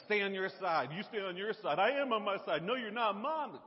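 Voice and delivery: loud squabbling siblings; bickering kids. Retold or imitated. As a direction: snap at each other like kids. Kids bickering over invisible line.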